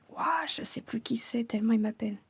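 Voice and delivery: hushed, breathy retold quote; lightly imitated whisper of a boy; whispered retelling. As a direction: whisper the boy's complaint under your breath. Retold boy's words, whispered.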